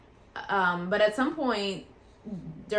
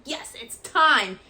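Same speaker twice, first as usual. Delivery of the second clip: slow, louder happy announcement; louder excited nurse voice; 5 semitones higher, 7 dB louder, much slower than usual. Retold or imitated. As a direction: draw it out with glee. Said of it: "She was so excited".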